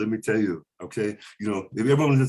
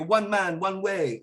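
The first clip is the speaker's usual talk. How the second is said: put-on Romanian accent; 7 semitones higher, much slower than usual. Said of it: "they go".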